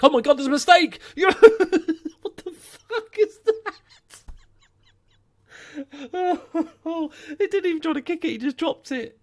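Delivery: very high-pitched incredulous laughing cry; laughing in disbelief. Real, not acted. Gamer seeing the keeper just drop it, hysterical laughing disbelief. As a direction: shriek with real helpless laughter. Keeper's blunder gifts a chance.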